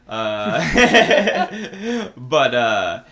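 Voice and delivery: real burst of loud laughter. Real, not acted. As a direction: crack up out loud.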